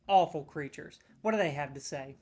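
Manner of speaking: normal talking voice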